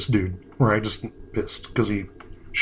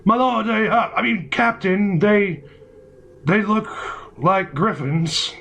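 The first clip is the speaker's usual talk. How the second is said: higher, stammering soldier, laughing through it; nervous soldier; 8 semitones higher than usual. Acted. Soldier reporting to his captain, higher and laughing.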